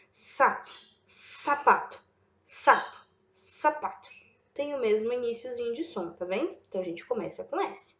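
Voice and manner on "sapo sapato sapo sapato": "Sapo, sapato" whispered to show the same opening S; whispered sound-out. Shown as a demonstration. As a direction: breathe each word to compare the sound "sa". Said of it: "Chama a palavrinha". (sussurrando sapo e sapato)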